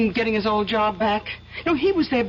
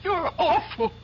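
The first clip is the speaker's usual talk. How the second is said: tearful, slow and breaking; hurt wife near tears; crying; 4 semitones higher, 4 dB quieter, slower than usual. Acted. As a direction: sob out the reproach. Wife upset at accusation.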